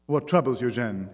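ordinary delivery, nothing marked

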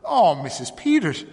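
high, loud shocked exclamation; distressed cry. A narrator's reading. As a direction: cry out to her in dismay. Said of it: "she cried".

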